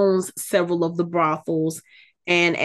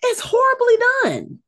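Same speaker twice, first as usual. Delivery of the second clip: high pitched disgust; high, pained disgust; exasperated squeal; slower than usual. Real, not acted. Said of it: "like running your fingernails over a chalkboard".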